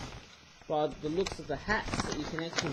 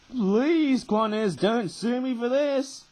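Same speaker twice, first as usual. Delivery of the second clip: loud, high, slowed-down begging; mock plea; 6 semitones higher, 8 dB louder, slower than usual. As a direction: cry out a hammed-up plea to Qantas. Begs airline not to sue.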